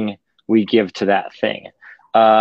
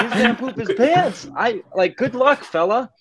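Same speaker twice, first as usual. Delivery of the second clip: high, giggly mock pity; laughing; 11 semitones higher than usual. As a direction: laugh the words out, teasing the driver. Laughing through own retold joke.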